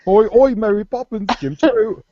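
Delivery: high-pitched, hammed-up Cockney; squeaky cartoon chimney-sweep Cockney; mock Cockney accent. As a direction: do a silly musical-film Cockney. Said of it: "Cockney accent".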